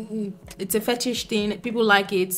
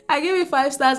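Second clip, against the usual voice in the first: laughing it out, pitched up; 7 semitones higher than usual. Real, not acted. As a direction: giggle through the repeated rating. Repeats rating laughing.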